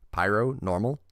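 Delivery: ordinary talk, nothing marked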